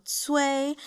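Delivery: Cui sustained high and level; Mandarin first tone. Shown as a demonstration. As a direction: hold Cui on an even high tone. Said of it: "That's gonna be the first tone".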